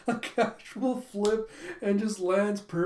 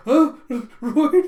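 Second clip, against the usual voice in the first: louder and higher, squeaking his name with a laugh; flustered surprise; 8 semitones higher, 12 dB louder than usual. Retold or imitated. Yor hearing Lloyd's confession, loud flustered squeak. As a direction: caught off guard by a confession, half laughing. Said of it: "And she's like".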